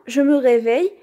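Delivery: plain reading voice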